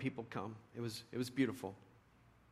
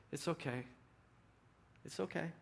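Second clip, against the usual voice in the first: quiet, gentle soothing; calm him down softly, over and over; soft soothing voice to a drunk heckler; soft reassurance; 4 semitones higher, 8 dB quieter than usual. Retold or imitated. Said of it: "she would reach out".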